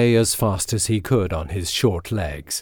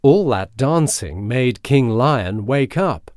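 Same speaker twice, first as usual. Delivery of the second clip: usual speaking voice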